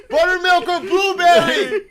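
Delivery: high, loud, slow, laughing; laughing slow shout. Retold or imitated. To grandpa at Norm's, laughing shout. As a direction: shout it for him through laughter. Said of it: "I'm like".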